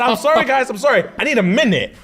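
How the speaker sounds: high, pleading apology; mock bawling husband. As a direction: imitate a man begging for a moment to recover. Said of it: "bro, the husband's like, Barling".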